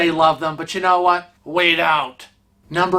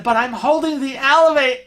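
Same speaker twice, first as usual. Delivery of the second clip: put-on girl's protest; 7 semitones higher, faster than usual. Daughter protests to father.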